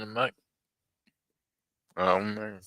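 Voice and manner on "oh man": normal talking voice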